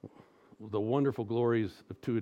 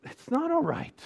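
high-pitched, insistent contradiction; emphatic rebuttal; 3 dB louder than usual. Real, not acted. Preacher rebutting the mom's comforting words, emphatic. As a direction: push back hard, pitch jumping up.

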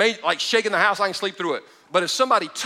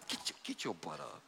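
hissed under the breath, get your butt up; a whispered, impatient angel; mock angel whisper; 8 semitones lower, 20 dB quieter than usual. Preacher voices the ticked angel in a rough whisper. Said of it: "The angel was like".

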